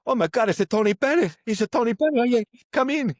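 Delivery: high, loud gushing welcome; excited Italian family. Retold or imitated. Thrilled family greeting a star at the door, gushing. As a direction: starstruck family fussing over the guest. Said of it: "the Italian family's like".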